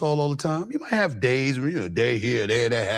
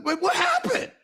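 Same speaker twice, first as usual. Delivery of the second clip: high surprised squeak, Cardell acting baffled; mock surprise. Friend Cardell reacting to the breakup, high startled mock question. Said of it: "Cardell's coming to me".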